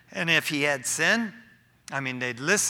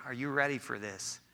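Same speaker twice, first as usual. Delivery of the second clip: ordinary talk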